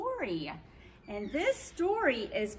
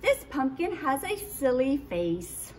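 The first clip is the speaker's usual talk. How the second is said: plain reading voice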